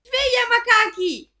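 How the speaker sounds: shouting wake-up call. Acted. Waking everyone up.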